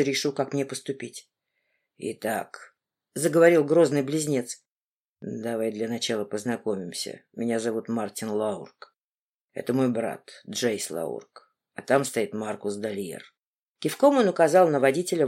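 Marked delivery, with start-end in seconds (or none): 2.0-2.7 low, quiet menacing man; a grim interrogator, lowered; stern man's voice. Acted. Stern twin opens the interrogation. 5.2-13.2 low, quiet menacing man; a grim interrogator, lowered; stern man's voice. Acted. Twin introduces everyone, voiced low.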